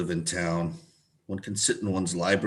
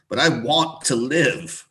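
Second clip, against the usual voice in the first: slow, louder insistence; slow insistent plea; 4 semitones higher, 6 dB louder than usual. Acted. Old man insisting to the doctor, slow loud plea. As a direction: lean on each word, pleading.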